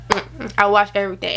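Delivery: ordinary talk, nothing marked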